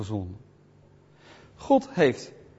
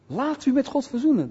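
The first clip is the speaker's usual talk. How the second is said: high-pitched evangelist's call; proclaiming appeal; 8 semitones higher than usual. Shown as a demonstration. Preacher showing how one calls people to God, proclaiming. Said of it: "Dat verkondig je".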